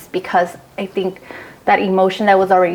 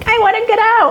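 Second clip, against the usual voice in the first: 1 butterflies' voice; 14 semitones higher than usual. Pretend.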